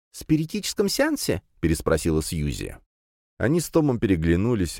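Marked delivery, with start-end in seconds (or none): 0.1-1.4 высоко и недоверчиво переспросить; переспросить с испугом в голосе; incredulous. Acted. Susie asks incredulous. (недоверчивый вопрос)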